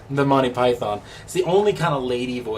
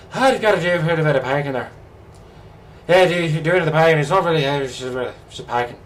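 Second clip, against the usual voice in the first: put-on garbled accent from Snatch, higher pitched; Snatch-style accent; 5 semitones higher, 5 dB louder, faster than usual. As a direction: rattle it off in a mumbled Snatch accent. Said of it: "if anyone's ever seen Snatch".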